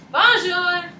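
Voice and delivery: high, loud, gleeful French greeting; enthusiastic bonjour. Shown as a demonstration. Teacher re-enacting her France greeting, high enthusiastic bonjour. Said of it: "that's literally how I said it every time".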